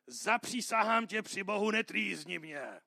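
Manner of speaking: loud, strained cry of the possessed man; demon's roar. Retold or imitated. Pastor voices the demon crying out to Jesus, loud. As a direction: bellow it at Jesus. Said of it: "Silným hlasem zařval".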